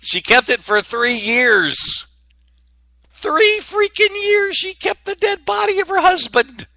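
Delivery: slow, very high squeal of disbelief; high-pitched, stretched-out astonishment; incredulous outburst. Real, not acted. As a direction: climb into a falsetto, aghast and drawn out. Incredulous punchline to shocking story.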